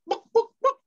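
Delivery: high clucking chicken voice, book as a cluck. Acted. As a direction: cluck the words like a hen. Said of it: "A chicken walks into a library and says".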